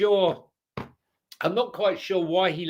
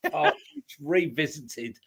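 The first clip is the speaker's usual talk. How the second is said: laughing; 4 dB quieter, slower than usual. Real, not acted.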